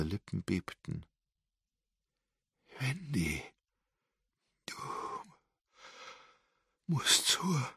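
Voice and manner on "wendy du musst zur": weak, high, straining words of a dying woman; failing voice. A narrator's reading. Dying grandmother struggles to warn Wendy, voice failing. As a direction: voice giving out mid-sentence. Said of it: "ihr versagte die Stimme".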